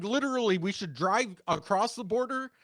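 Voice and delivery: ordinary talk, nothing marked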